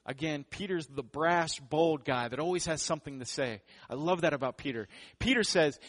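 ordinary delivery

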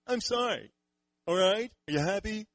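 high, mock-whiny fake apology; sulky kid forced to apologize; hammed-up grudging apology, pitched high; grudging sorry.

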